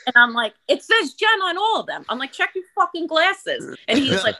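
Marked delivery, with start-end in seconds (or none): 0.7-2.0 high-pitched retold outburst at the cook; Jersey Jen temper. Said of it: "Jen went into, like, Jersey Jen". 2.3-3.7 high, scornful retold insult; Jersey Jen temper. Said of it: "Jen went into, like, Jersey Jen".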